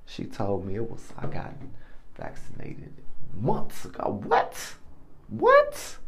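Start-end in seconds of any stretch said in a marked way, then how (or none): 5.3-6.0 shocked squeal. Acted. Shock at wife's secret vaccine.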